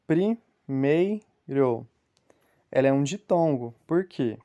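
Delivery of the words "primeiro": Primeiro bem devagar, sílaba por sílaba; slow syllable by syllable. Shown as a demonstration. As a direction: stretch out Primeiro to show its syllables. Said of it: "quando nós pronunciamos e quando nós separamos". ("Primeiro" pronunciado devagar, separando as sílabas)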